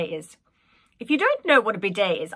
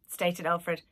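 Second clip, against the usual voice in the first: plain reading voice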